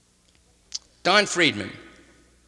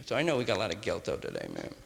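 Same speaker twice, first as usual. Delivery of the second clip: ordinary delivery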